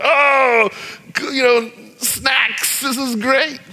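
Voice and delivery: high-pitched gushing student; lightly hammed-up excitement over a treat; mock delight. College student raving about free snacks, mock delight.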